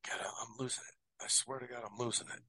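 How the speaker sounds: quiet self-reproach, half to yourself; muttered aside. Real, not acted. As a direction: mutter it under your breath. Muttering to himself over confused dates.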